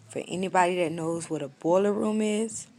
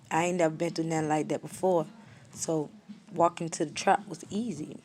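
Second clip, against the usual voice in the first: ordinary delivery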